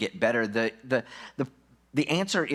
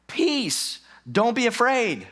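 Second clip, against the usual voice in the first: much higher, booming call from afar; voice of God; 11 semitones higher, 5 dB louder, slower than usual. Acted. God's voice answering the screaming Gideon, high and loud. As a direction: call down from heaven to calm him.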